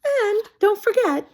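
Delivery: gleeful Piggy; Piggy teasing. Acted. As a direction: rub it in playfully.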